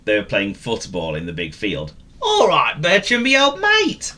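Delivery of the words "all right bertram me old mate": high, loud friendly call; a dog calls his mate over. Acted. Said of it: "called Willy".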